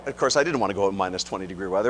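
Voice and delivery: ordinary delivery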